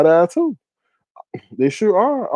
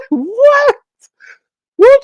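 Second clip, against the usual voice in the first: very high, loud laughing what; laughing mock shock; 8 dB louder than usual. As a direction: squeal it in disbelief.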